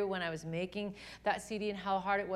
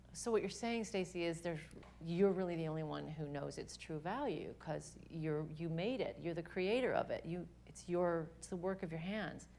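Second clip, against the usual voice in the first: softer, calm reasoning; gentle divine voice; 4 semitones lower, 7 dB quieter than usual. Retold or imitated. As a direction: speak tenderly, like God patiently reasoning. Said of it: "the Holy Spirit ... Let us reason".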